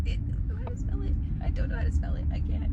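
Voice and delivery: put-on high, breathy little-kid sob; mock child crying. Crying child tells the teacher she can't spell the word, high and teary. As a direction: act out a kindergartner crying over spelling. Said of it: "And so I start crying".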